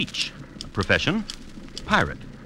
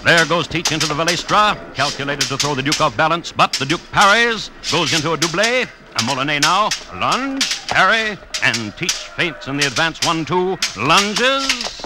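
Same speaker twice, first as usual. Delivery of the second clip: rising, louder, faster blow-by-blow call; excited play-by-play; 5 semitones higher, 4 dB louder than usual. A narrator's reading. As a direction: call the duel like a live match, swept up in it. Narrator calls the swordfight live.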